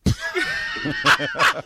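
high, loud breathy laugh. Real, not acted. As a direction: burst out laughing. Bursts out laughing.

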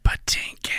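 whispered, haunting name call; ghostly whisper. Retold or imitated. How I Met Your Mother reference.

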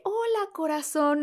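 lilting slow hello. Retold or imitated. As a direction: greet like a gracious star. [name] greeting her.

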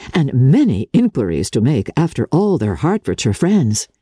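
plain reading voice

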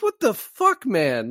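a yelp of stunned disbelief; a high, incredulous cry; shocked outburst. Retold or imitated. Retelling his own shocked reaction.

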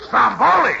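a high, loud "Stromboli?" in disbelief; can't believe the answer; incredulous. Acted. Trainer hearing where the midget comes from, shocked disbelief.

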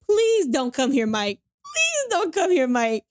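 imitated pleading, climbing high on the repeat; retold pleading. Woman begs boyfriend not to come over, imitated high pleading. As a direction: beg him, stretching the second please up high. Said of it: "like, just pleading".

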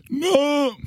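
high anguished cry of no; Charlton Heston despair. Retold or imitated. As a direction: howl it like a ruined hero. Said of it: "How does he?".

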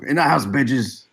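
lightly done London growl; echoing the Roy Kent bit; Roy Kent impression. Joining the dog-scolding bit, mock Roy Kent. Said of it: "like I'm Roy Kent".

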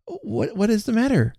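high-pitched, puzzled mother; startled question. A narrator's reading. Mother to bursting-in children, startled. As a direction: ask in high-pitched surprise.

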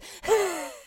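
high, overdone heaving breaths; exaggerated breathless wheeze; mock gasping. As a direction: imitate melodramatic fighting for air. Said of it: "He is".

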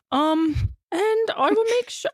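high-pitched mock Scottish; bad Scottish accent. Player pressing on until the timer ends, bad Scottish accent.

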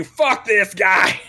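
slow, drawn-out mock contempt; mock outrage. Rock star leaving a one-star review, mock outrage drawn out slowly. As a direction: stretch every word in hammed-up anger. Acts out the star's angry review.

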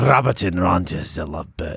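donor names in a put-on Russian accent. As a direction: read it out as a comic Russian. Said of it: "I need you to do a Russian accent".

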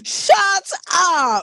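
high-pitched, astonished outburst; amazed disbelief. Real, not acted. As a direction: burst out in delighted disbelief. Astonished at the pronunciation reveal.

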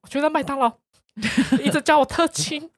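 hammed-up tearful complaint to the manager; mock crying whine. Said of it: "我的脸是真的是皱在一起".

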